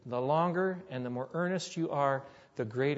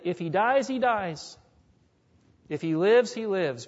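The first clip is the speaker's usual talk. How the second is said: tearful surrender; 4 semitones higher, 6 dB louder than usual. Retold or imitated. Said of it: "With tears in her eyes".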